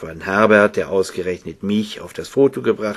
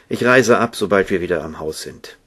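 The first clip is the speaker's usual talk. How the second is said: ordinary delivery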